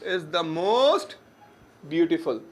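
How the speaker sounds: "is the most" drawn out word by word, then "beautiful"; slow teaching emphasis. Shown as a demonstration. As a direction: spell out "is the most" very slowly for the class. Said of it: "सबसे ज़्यादा beautiful है".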